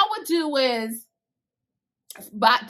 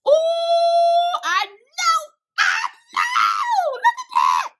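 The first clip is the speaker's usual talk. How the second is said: high, loud shrieks of delight; real overjoyed shrieking; excited squeals; 4 dB louder, much slower than usual. Real, not acted. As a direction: squeal like you just unwrapped a gift. Gushing over new crochet hook.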